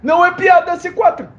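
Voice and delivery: excited. Real, not acted. Excited game outburst.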